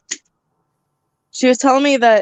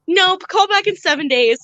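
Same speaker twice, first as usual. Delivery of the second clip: higher, sing-song mock of the rep; mocking dismissive rep; 9 semitones higher than usual. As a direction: parrot the rep's brush-off, higher. Mimics rep's dismissive reply.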